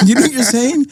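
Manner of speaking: a teacher who can't believe the word; teacher in disbelief; incredulous teacher. Retold or imitated. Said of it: "my teacher said".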